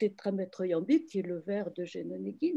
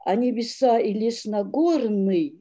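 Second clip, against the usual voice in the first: reading the last Russian stanza aloud, louder; poem reading; 7 dB louder than usual. Shown as a demonstration. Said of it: "je vais vous lire la dernière strophe".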